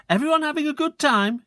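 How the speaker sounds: very high, chirpy mum; sing-song hostess; mother's voice. Acted. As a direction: pop in cheerily. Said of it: "she asked".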